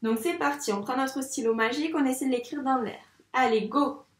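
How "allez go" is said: ordinary talk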